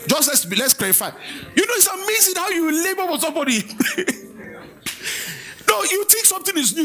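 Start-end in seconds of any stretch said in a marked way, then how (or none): 1.5-6.8 laughing. Real, not acted. Laughing while preaching.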